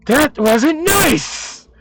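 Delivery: high, slow, indignant cat voice; talking cat. Acted. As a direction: scold like a kicked cat, stretching the words out high. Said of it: "and then it says".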